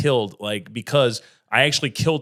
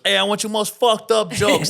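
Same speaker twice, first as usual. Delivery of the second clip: put-on a laughing, botched British accent, higher and louder; bad British accent; 8 semitones higher, 5 dB louder than usual. Comic mocking the British show host in a hammed-up British accent. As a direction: overdo a British voice that slides toward Italian. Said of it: "That's my attempt at a British accent".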